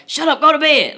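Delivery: high, loud snapping mother; harsh mom yell. Retold or imitated. Imitating her mom shutting the kids down, loud snap. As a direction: bark it like an old-school mom. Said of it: "It was just like".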